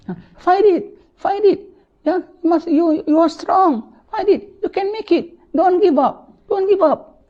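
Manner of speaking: high, pleading urging to fight on; desperate relative. Acted. Relative at the bedside urging the dying to fight, high and desperate. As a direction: beg them not to give up, pitched high.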